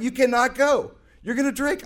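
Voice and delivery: high and loud prohibition; stern warning. Retold or imitated. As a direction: lay down the law. Members forbidding the concert.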